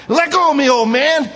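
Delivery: high, loud mock yell at an old man; a hammed-up rebellious son; defiant yell. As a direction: shove him off with your voice. Son resisting father, modern take.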